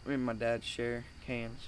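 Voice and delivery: ordinary talk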